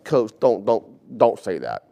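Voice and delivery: students telling the coach off, half-mocking; a hint of a teen voice, scolding; lightly voiced teen scolding. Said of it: "figure like".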